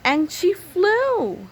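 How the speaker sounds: high, loud and very slow; triumphant climax. A narrator's reading. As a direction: savor the triumph. Climax of story.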